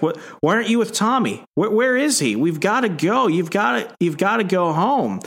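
high, fretting mother's voice; anxious mother. Retold or imitated. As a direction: fret at the child, hurried. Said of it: "she's like".

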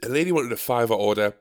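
ordinary talk, nothing marked

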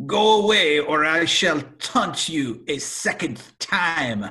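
hammed-up mock warning; taunting French knight. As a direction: draw it out like a pompous film taunt.

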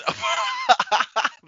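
very high shriek of laughter; squealing laugh. Real, not acted. Reaction to trade.

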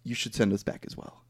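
low, very soft mock solemnity; hushed, deep earnest voice; solemn film characters. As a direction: imitate a grave, quiet request. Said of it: "And then they're like".

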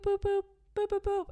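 high beeping boops; imitated timer beeps. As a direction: mimic a timer's beep. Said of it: "you keep hearing the".

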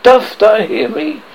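slow, high, loud summons; drawn-out theatrical demand; crying out. A narrator's reading. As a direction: call out slowly to an unseen spirit. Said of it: "he cried".

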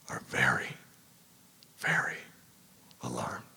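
hushed, low emphatic whisper. Real, not acted. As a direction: whisper it for effect. Contrast with non-alarmist parents.

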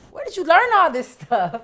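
imitating mom's very loud, high outburst; shocked mother. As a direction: shriek it like an appalled mom, laugh breaking in. Mother hears uncensored song, appalled.

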